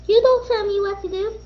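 high, fast defiant talk-back; child sassing teacher, high and fast. Acted. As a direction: sass the teacher quickly.

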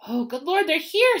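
high, loud flustered panic; frantic. A narrator's reading. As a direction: climb in pitch into a frantic cry. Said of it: "said Aunt Petunia frantically".

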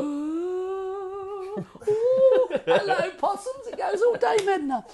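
put-on high flipped girly voice, laughing. Shown as a demonstration. Coach shows the student flipping into a girly voice. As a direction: flip up into a silly girly voice. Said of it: "goes to the girly voice".

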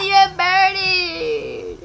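high, slow wail of alarm; drawn-out wail. Real, not acted. Boy telling friend he is burning, high drawn-out wail. Screams as friend burns in game.

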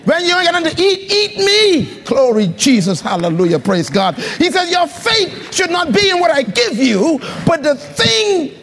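voicing God's call to the congregation, fired up; full-force preaching. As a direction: drive the message home like a preacher.

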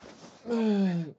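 long exasperated sigh. Real, not acted. Gamer asked to talk without an accent, exasperated sigh. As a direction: let out a heavy, fed-up sigh.

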